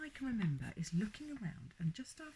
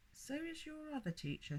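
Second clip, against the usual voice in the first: dry; 9 semitones higher than usual. A narrator's reading. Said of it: "said George dryly".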